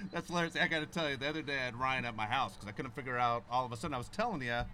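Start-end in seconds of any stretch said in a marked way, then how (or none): none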